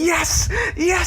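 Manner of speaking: hammed-up victory cry; mock bowler yell. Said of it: "repeating the bowler's yell".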